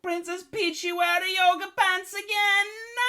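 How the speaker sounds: high, loud hammed-up Italian plumber; mock Italian accent. Luigi calling out to Princess Peach. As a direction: belt it in a cartoon Italian accent. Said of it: "What the fuck kind of accent is that?".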